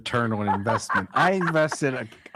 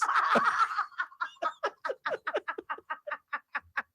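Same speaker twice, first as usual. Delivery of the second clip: high squealing laugh; 3 dB quieter than usual. Real, not acted. Laughing at own joke.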